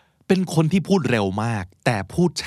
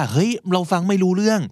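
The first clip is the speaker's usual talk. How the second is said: worried self-talk; 8 semitones higher than usual. Retold or imitated. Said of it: "อย่าไปคิดว่า".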